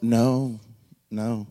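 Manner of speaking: ordinary talk, nothing marked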